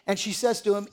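ordinary talk

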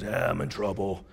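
low, sheepish biker voice; biker confessing. Retold or imitated. Biker owning up to his pastor, low and sheepish. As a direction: own up in a low voice.

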